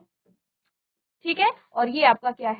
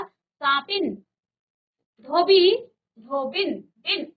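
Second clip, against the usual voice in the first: usual speaking voice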